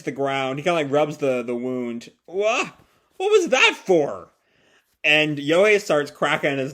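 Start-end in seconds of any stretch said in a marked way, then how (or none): 2.3-4.3 mock shocked protest. Nagi hit by his father.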